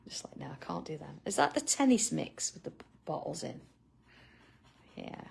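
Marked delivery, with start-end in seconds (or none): 0.1-1.2 whispered aside. Real, not acted. Aside while laughing about sweets.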